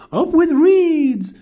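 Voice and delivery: high, loud hoot; big hooting storybook chant; hooting cheer. A narrator's reading. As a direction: hoot it out like a rallying cry. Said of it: "they hooted".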